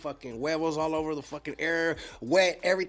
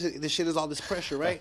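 ordinary talk, nothing marked

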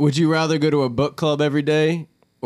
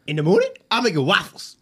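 high, loud Scottish ogre voice; cartoon ogre, pitched up and loud; Shrek impression; 7 semitones higher, 5 dB louder than usual. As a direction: put on a raised cartoon Scottish accent. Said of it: "give me your best Shrek impersonation".

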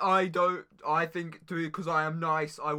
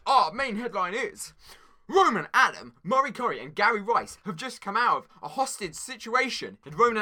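high, loud anchor headline voice; mock news read; 5 semitones higher, 7 dB louder than usual. Anchor opening the world news, mock bulletin delivery. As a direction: announce it like a big breaking headline.

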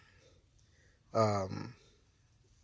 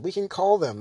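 ordinary delivery

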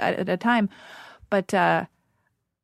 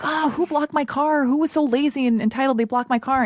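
mock indignant; 7 semitones higher than usual. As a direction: ham up the huffy complaint. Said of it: "I was like".

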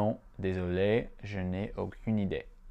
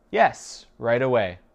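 connected reply with a raised, punched opening; ready answer; 2 semitones higher, 6 dB louder than usual. Shown as a demonstration. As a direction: answer promptly, pitched up and louder. Teacher gives whole answer first.

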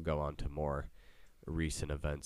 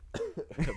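burp laugh; 12 semitones higher than usual. Real, not acted.

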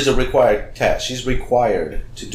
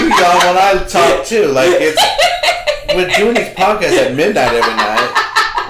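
high, loud laughing indignation; laughing protest; 9 semitones higher, 8 dB louder, slower than usual. Real, not acted. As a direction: protest while cracking up. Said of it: "Fucking with you, it's so fun".

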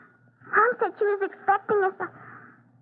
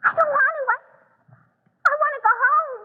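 higher, louder, frightened; scared refusal; 7 semitones higher, 3 dB louder than usual. Acted. Sister refusing to go up to the attic, higher and scared. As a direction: plead to go home.

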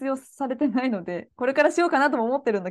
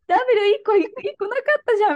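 mock correction; 10 semitones higher, 5 dB louder than usual. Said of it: "ダブリュー二つしか言ってなかった".

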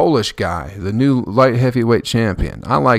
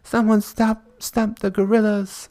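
high, slow panicky woman's plea; shrill-free mock panic, pitched way up and drawn out; screaming lady; 12 semitones higher, slower than usual. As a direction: mimic a frantic lady begging someone to step in. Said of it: "she's just like".

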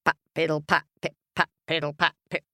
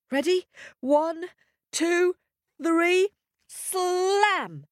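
climbing higher with each number; building count; 13 semitones higher, slower than usual. Acted. Counting the children in to the slam, climbing higher.

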